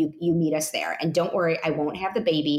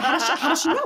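puzzled husband; 10 semitones higher, 3 dB louder, much faster than usual. Retold or imitated. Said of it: "and he's like".

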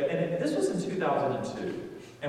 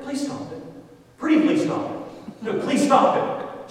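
high, strained begging; acted upset pleading; 11 semitones higher, 6 dB louder than usual. Embarrassed husband begging wife to stop, upset pleading. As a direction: plead high, harder each time. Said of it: "I'm getting upset with her".

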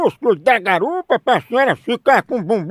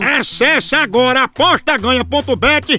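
upbeat radio ad voice; ad read; 6 semitones higher than usual. Acted. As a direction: sell it like a radio spot.

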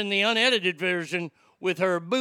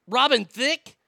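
louder, higher, rising disbelief; incredulous; 6 semitones higher, 4 dB louder, slower than usual. Real, not acted. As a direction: scoff in disbelief. Incredulous repeat question.

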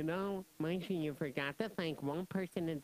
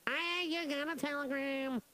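telegram delivery call; 6 semitones higher than usual. Pretend. Co-host jokingly delivers a telegram.

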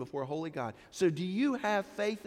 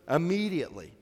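"Immediately" punched then falling; "Immediately" said firmly, falling; repeated emphasis. Shown as a demonstration. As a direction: stress "Immediately" once more. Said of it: "Mark puts it there again".

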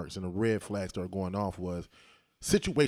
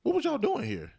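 friendly; 7 semitones higher than usual. Retold or imitated. Retelling friendly question to minister.

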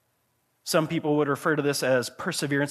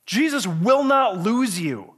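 usual speaking voice

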